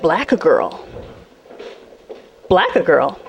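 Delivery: low, slow disbelieving echo; baffled repeat. Retold or imitated. Stunned driver's victim repeating the odd insult low. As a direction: turn the insult over in disbelief.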